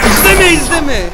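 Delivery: high, loud don't watch; urgent loud plea. Real, not acted. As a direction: yell the warning at yourself. Begging himself not to watch. (yüksek sesle yalvarış)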